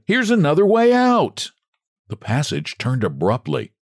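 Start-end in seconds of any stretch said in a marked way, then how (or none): 0.1-1.5 high, louder eager discovery; announcing a way out; little man excited at a way out. A narrator's reading. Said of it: "he said".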